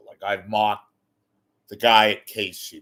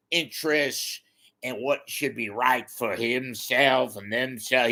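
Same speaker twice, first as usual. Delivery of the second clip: ordinary talk, nothing marked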